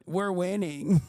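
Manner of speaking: mock cheer after bad news; bitter sarcasm. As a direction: land the slogan with grim irony. Ironic slogan after bad news.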